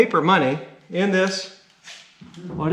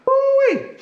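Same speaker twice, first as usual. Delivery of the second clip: high woo-wee through the dust; coughing on dust; 6 dB louder than usual. Real, not acted. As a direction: clear the dust with a whoop. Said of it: "The dust is getting to me".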